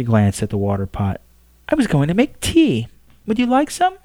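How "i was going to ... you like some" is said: higher, gentle courteous woman; polite Pope. Acted. Pope offers her visitor a seat, courteous. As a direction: offer the chair kindly.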